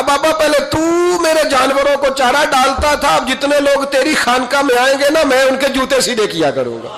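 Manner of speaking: high, loud plea to the saint, "Baba" called out. Retold or imitated. Servant begging the saint to let him serve, loud high plea. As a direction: beg the old master eagerly and loudly.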